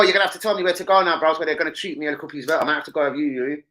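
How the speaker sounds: normal talking voice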